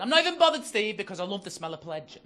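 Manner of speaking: loud, high retort, easing down as it goes; wife's defiant retort. Retold or imitated. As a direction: burst in high as the defiant wife. Acted wife's retort in row.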